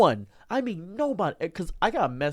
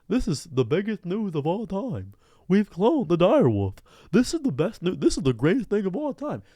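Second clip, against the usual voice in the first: hyped TikTok guy; 3 semitones higher than usual. Retold or imitated. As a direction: ham up the breathless news hype. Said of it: "I see a dude on TikTok talk about".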